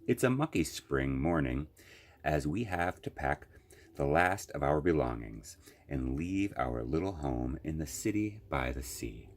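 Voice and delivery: usual speaking voice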